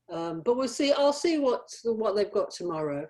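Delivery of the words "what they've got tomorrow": usual speaking voice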